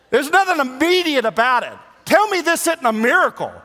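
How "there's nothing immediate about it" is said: usual speaking voice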